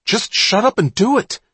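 high, loud, fierce command; angry shout. Acted. Johnny snapping at Malin to obey, loud and angry. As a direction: bark it at him to stop arguing. Boys arguing in dark tunnel.

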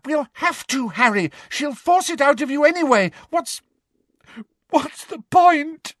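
high-pitched despairing plea; on verge of crying. A narrator's reading. Hermione gives in before Harry, desperately near tears. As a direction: plead high, giving up at the end. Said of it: "Hermione began to cry weakly".

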